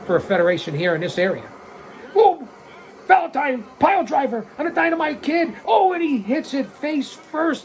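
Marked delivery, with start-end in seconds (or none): 2.2-7.6 high, rising play-by-play burst; excited call. Real, not acted. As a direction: call the pile driver like it shocks you. Pile driver lands, commentator erupts.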